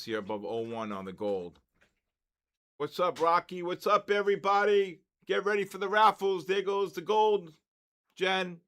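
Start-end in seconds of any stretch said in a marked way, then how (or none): 2.8-8.6 higher and louder, pumped-up hellos; excited greeting. Real, not acted. Trader greeting his viewers live, loud and excited. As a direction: hype up the chat as gold moves.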